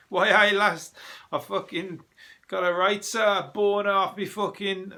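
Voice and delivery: hammed-up Geordie lass, pitched high; bad Geordie accent. Comic mimicking Geordie lasses overheard in pub, bad Geordie accent. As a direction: attempt a broad Geordie, loud and high-pitched. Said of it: "I can't do Geordie accents".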